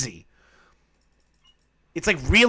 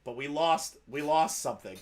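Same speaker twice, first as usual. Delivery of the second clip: ordinary talk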